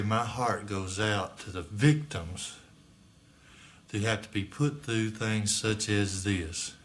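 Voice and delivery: ordinary delivery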